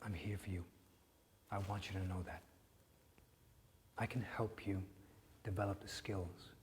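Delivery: hushed, gentle reassurance; soft whisper. Acted. Therapist quietly reaching out to Jack, hushed whisper. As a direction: whisper it close, like comfort.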